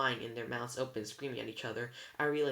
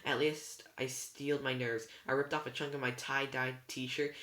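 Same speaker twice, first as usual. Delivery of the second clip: plain reading voice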